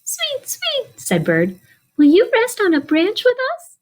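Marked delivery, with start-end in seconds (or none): 0.0-1.0 very high chirped bird call; high sweet birdsong call. Acted. Bird call in picture book. 2.0-3.8 much higher, a bit louder bird voice; storyteller voicing a bird, high. Bird speaks in picture book.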